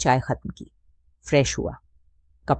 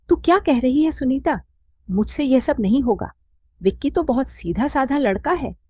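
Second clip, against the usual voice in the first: high, raised shocked objection; shocked protest; 9 semitones higher than usual. A narrator's reading. Radha recoils at her friend's suggestion, high and shocked. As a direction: balk at the idea, pitch up.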